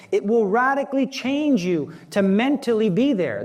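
preacher swept up in mystery; fervent preaching. Acted. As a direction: build the fervour of the Mass. Preaching at rising intensity.

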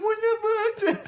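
high-pitched, hammed-up crying protest; mock wail. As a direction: wail like a kid who just got hit. Retold reaction to being hit.

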